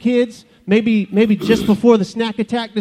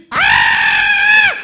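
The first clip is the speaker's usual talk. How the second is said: long, very high comic scream; hammed-up scream. Bush finds its branches burning, hammed-up scream. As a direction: scream high in mock panic. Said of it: "I started screaming".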